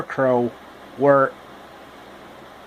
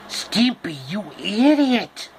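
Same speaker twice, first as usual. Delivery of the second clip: Ren impression; 6 semitones higher, slower than usual.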